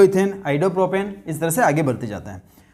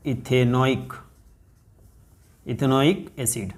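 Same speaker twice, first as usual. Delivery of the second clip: Ethanoic acid drawn out slowly and softer; slow softer answer; 4 semitones lower, 4 dB quieter, much slower than usual. Shown as a demonstration. Teacher slowly answering her own question for students. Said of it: "IUPAC name क्या हो जाएगा?".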